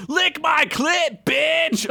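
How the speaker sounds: high taunting yell, slow. Acted. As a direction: jeer it at the door. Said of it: "while yelling".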